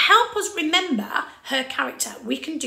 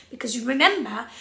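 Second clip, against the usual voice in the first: usual speaking voice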